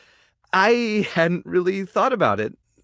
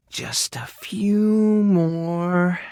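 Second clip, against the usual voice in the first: very slow, last word held long; drawn-out concentrating; much slower than usual. Acted. Groomer working on an animal mid-chat, words drawn out. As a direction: stretch it out while busy with a task.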